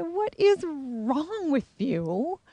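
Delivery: high, slow, disbelieving question; baffled retort. Retold or imitated. Retelling her stunned reply to a strange man, high and slow. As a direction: drawl it out, stunned by him.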